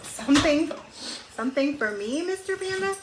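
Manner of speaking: a goat thrilled there's a gift for him; excited goat. A narrator's reading. Reading aloud, the goat asks high and eager.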